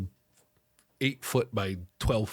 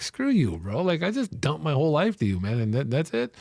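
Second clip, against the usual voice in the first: high, affronted mock retort; imitated exasperated protest; retold indignation; 7 semitones higher than usual. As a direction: act out being offended by the brush-off.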